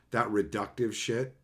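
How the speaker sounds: ordinary delivery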